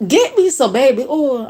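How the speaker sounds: put-on high teasing call for the baby oil; pretend request. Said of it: "I'm gonna say".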